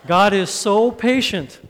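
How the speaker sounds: slow, loud, high-pitched proclamation; emphatic declaration. Real, not acted. Preacher restating the point after the laugh, loud and emphatic. Repeats the point over reaction.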